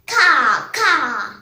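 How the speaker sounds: two loud imitated caws; crow cawing. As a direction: caw loudly like a crow. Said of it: "the crow said".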